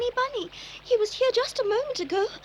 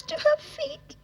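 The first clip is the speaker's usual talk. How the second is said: crying; 7 semitones higher, slower than usual. Acted. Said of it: "stop crying".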